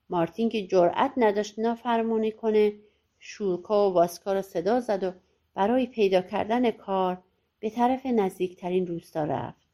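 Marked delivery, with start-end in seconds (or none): none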